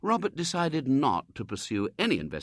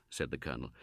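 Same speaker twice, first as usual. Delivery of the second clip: usual speaking voice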